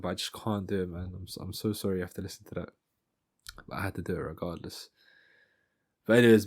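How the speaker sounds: ordinary talk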